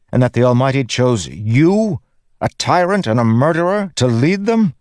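high, heated challenge climbing to incredulity; indignant tirade rising into a disbelieving question; snapping, building to outrage. A narrator's reading. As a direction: confront him angrily, pitch soaring by the end. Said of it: "she snapped".

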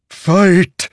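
high loud commanding sword voice; ringing metallic sword voice. Acted. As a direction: bark it like a hungry blade. The sword demands.

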